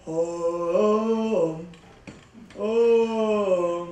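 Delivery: low, dragging groan of a weary guy; weighed-down boyfriend groaning; mock groan.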